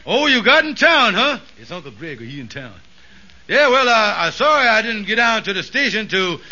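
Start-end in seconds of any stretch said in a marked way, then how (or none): 1.6-2.7 hushed aside away from the phone; quiet aside. Acted. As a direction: drop the voice to a quick quiet aside.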